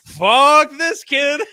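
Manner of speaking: high, loud and dragged out; slow loud exasperated curse. Retold or imitated. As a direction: stretch it out in loud disgust. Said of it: "then they're like".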